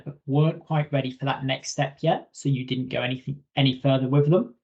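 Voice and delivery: ordinary talk, nothing marked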